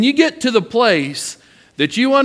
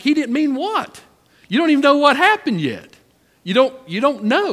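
higher, incredulous questioning; mock exasperation; 7 semitones higher than usual. Husband questioning his wife's quick excuse, exasperated and higher. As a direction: push back like a baffled dad. Retold exasperated reply to wife.

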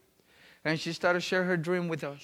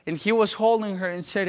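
ordinary delivery

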